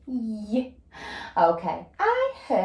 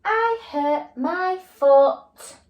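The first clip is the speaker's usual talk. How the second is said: very slow, higher, louder read-back; slow check reading; 10 semitones higher, 6 dB louder, much slower than usual. Shown as a demonstration. Teacher rereading her sentence to check it, slow and emphatic. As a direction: read it back slowly, word by word. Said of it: "I'm gonna check my sentence".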